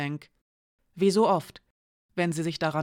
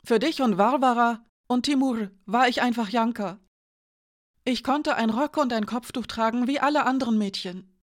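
put-on higher, disbelieving young woman; Janka's voice; 5 semitones higher than usual. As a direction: voice Janka higher, shaken and accusing. Janka confesses her longing.

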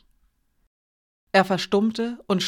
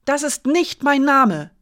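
loud slow cry, pitched high; shouted; 7 semitones higher, 6 dB louder than usual. A narrator's reading. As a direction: cry it out in protest. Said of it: "schrie Janka". (Janka schreit empört)

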